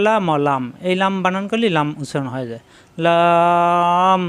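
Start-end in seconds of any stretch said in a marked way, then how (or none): 3.0-4.2 লাম held very long on one level note; drawn-out letter name. Shown as a demonstration. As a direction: stretch the vowel of লাম steadily before closing. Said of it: "টান দেওয়া যায়".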